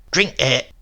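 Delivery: a hint of a stern housekeeper; firm order. Housekeeper firmly ordering the sick man to drink. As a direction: put the glass in his hands with the words.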